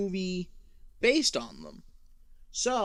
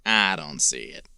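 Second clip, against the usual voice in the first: low, gruff New York uncle; cynical New York uncle; 9 semitones lower, 8 dB louder than usual. Retold or imitated. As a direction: sound like a cynical old uncle.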